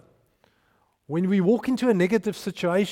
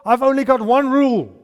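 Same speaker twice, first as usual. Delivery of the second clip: Joshua commanding; 6 dB louder than usual. Retold or imitated. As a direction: lay down the one rule to the people. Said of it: "he went to the people and said".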